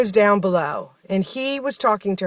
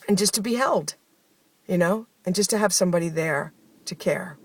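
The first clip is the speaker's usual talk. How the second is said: ordinary delivery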